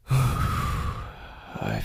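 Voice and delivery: sigh, then whisper the word; whispered after a sigh of relief; relieved sigh. Acted. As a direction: let out a content breath and whisper. Waking relaxed, sighs.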